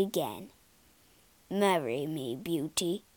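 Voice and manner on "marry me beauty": soft, low plea; quiet pleading. A narrator's reading. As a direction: ask gently and low. Beast proposes on one knee.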